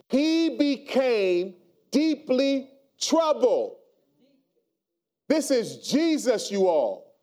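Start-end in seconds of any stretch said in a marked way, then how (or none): 0.1-3.8 drawn out slowly, each word weighed; slow heavy emphasis. Shown as a demonstration. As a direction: let every word sink in slowly. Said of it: "Lean on somebody and say".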